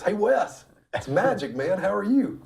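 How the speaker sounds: high, upbeat imitation of a caller; hearty phone greeting. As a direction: greet him brightly, pitched well up. Magic greets brother by phone, retold.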